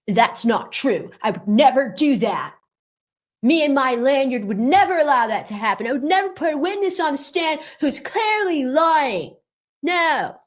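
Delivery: whiny cartoonish protest, climbing high; mock indignation rising to a high wail; mocking Lewin Lanyard. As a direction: protest like an outraged cartoon kid. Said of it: "This is just what he reminds me of".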